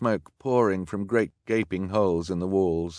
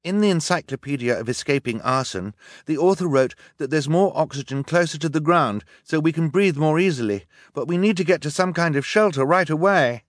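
high, loud, pressing explanation; urgent calling; 9 semitones higher, 7 dB louder than usual. A narrator's reading. Boy advises his sisters crawling under smoke, urgently. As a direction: explain it urgently over the fire. Boy calls out amid the fire.

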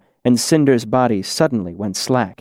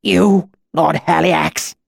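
high, slow, pained cry; shred of pain; 6 semitones higher, 3 dB louder, slower than usual. A narrator's reading. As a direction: force out the answer through pain. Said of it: "Cinder's voice was a quiet shred of pain".

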